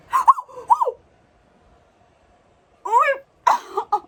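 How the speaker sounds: loud, very high shrieked ohs; real shrieks of delighted shock. Real, not acted. Fan seeing the card pulled, shocked shrieking.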